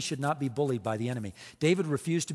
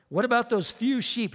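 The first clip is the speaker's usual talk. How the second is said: higher-pitched needling put-down; retold jab; 7 semitones higher, 3 dB louder than usual. Oldest brother putting David in his place, needling. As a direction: jab at a younger brother, mock scornful. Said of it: "here's a jab".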